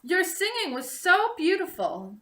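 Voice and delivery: a gently voiced young prince; lightly voiced prince. A narrator's reading. Prince praising Rapunzel's singing, lightly voiced and admiring. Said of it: "the prince told her".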